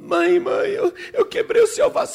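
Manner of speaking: put-on high-pitched childish crying voice; sobbing little girl. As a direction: confess through hammed-up sobs. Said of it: "a menina chorando, e a-, soluçando já".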